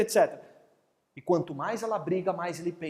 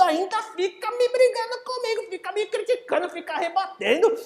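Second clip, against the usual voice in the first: high-pitched complaining mom; mother scolding; 13 semitones higher than usual. Acted. Mother complaining the son fights back, high nagging voice. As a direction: nag it up high like an outraged mother.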